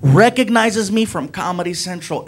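normal talking voice

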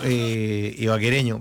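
ordinary talk, nothing marked

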